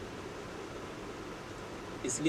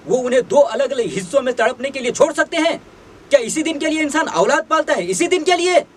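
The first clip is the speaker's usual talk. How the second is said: climbs from pleading to a much higher, much louder furious shout; rising outrage; 7 semitones higher, 9 dB louder than usual. Acted. Father demanding answers from ungrateful sons, furious building shout. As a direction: let the anger build until you are yelling at them.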